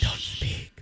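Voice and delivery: soft unvoiced whisper; a mock whispered plea for silence; whispered don't speak. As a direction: hush them with a whisper. Mock shushing after work.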